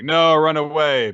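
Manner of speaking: hammed-up panicked shout; mock urgent warning. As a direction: yell at the kids to get away. Hero warns his siblings.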